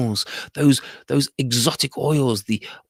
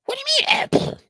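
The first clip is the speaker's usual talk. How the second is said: high baffled parrot question; a parrot not getting it; high confused squawk; puzzled parrot; faster than usual. Acted.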